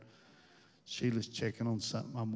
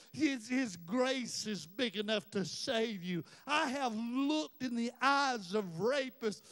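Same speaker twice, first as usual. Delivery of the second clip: higher, louder, chanting preacher cadence; full-force preaching; 3 dB louder than usual. Real, not acted. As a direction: preach it hard, drive each line.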